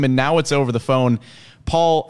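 ordinary delivery

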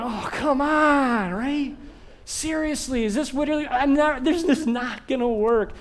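exasperated. Retold or imitated. Passenger fed up with the de-icing delay, exasperated. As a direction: stretch it out, fed up.

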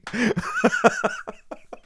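squealing laugh; laughing hard at her co-host's accents; high laugh. Real, not acted. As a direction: breaks up into high-pitched laughter. Laughing at the co-host.

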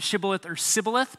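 Shibboleth with sh, then Sibboleth with a plain s; sh versus s. Shown as a demonstration. Preacher to congregation, Shibboleth sh then Sibboleth s. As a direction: contrast "Shibboleth" sh against "Sibboleth" s. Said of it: "there's a sh sound in Hebrew and there's a s sound".

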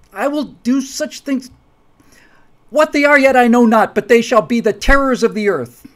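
loud, high declamation building to a shouted climax; theatrical vengeful tirade. Acted. Acted vengeful speech in brothers' quarrel.

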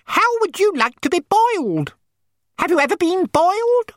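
very high, squeaky shrimp voice; indignant shrimps. A narrator's reading. Shrimps challenge the complaining toy dog, indignant. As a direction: squeal it as an affronted little shrimp.

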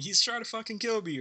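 high mock yell of panic; retold scream. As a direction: shout it like he nearly died in the blast. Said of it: "he was screaming".

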